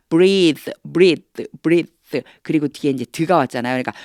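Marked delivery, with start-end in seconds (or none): 0.1-1.9 "breathe" with the tongue lightly bitten on th, said loud; tongue between teeth th. Shown as a demonstration. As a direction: bite the tongue for "breathe" th sound. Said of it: "혀를 살짝 깨물으면서요".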